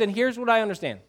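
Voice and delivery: ordinary delivery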